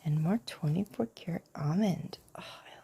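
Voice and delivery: soft unboxing whisper; hushed show-and-tell whisper; whispered ASMR. Real, not acted. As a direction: breathe the words close to the mic.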